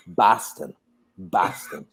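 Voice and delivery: low, quiet Boston-accented imitation; Frank's Boston accent. Friend revealing his hometown, in an imitated low Boston accent. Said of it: "Boston accents now sound like Frank".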